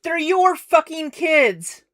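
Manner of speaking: loud, high-pitched angry outburst; outraged shout. Real, not acted. Streamer reacting to the clip about kids, shouting in outrage. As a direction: shout it in real disbelief and anger.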